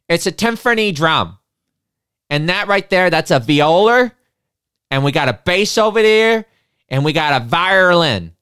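loud, high Boston working-class guy; thick Boston accent. Retold or imitated. Bald strongman in the back shouting in a Boston accent. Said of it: "in a very thick Boston accent".

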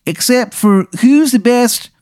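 high, drawn-out Baltimore accent put on thick; slow, high-pitched cartoon Baltimore accent; terrible Baltimore accent. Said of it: "you can hear his terrible accent".

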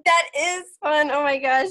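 big grin you can hear; smiling voice. Real, not acted. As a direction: say it through a wide smile. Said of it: "If you can't hear it in my voice, I'm smiling so big".

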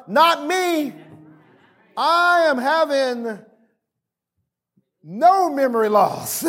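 high, slow, emphatic preaching; defiant declaration. Real, not acted. Preacher refusing talk of forgetting with age, defiant declaration. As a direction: declare it slowly, word by weighty word.

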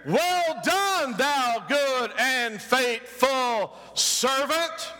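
high, slow Well done, drawn out servant; very high Well done, then slow, drawn-out faithful servant; heavenly welcome. Retold or imitated. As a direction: proclaim it as the Lord's praise. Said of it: "You know what you're gonna hear?".

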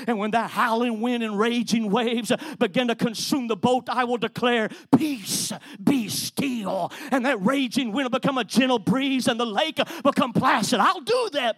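loud, rising preacher's cadence; full-force preaching. Acted. Preacher proclaiming Jesus' miracles to the church, loud. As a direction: build it like a pulpit climax.

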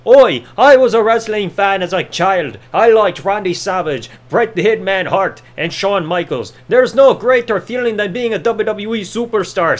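slow, lightly put-on Irish brogue; generic Irish wrestler promo, hammed up slowly; mock Sheamus Irish accent. Mocking Sheamus's generic promo, slow Irish accent. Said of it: "Then, of course".

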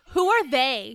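loud, hammed-up demand; mock exasperation. Mocking the book's vague talk of "they", loud exasperation. Acted outburst at vague answer.

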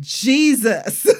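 loud cry of "Jesus" with a laugh; screamed out. Retold or imitated. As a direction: call his name like a half-laughing scream. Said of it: "That's all I screamed out".

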